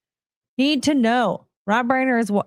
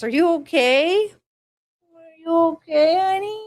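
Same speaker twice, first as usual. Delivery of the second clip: worried parent; 9 semitones higher than usual. Real, not acted. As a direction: anxiously coo at a fallen pet. Pet just took a fall.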